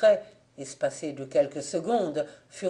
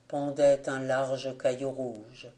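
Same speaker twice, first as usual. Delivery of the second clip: plain reading voice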